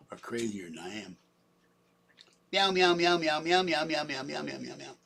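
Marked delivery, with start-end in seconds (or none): 2.5-5.0 meowing at cats. Pretend. Owner calling cats to dinner, meowing and fading. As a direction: call the cats with meows, trailing off.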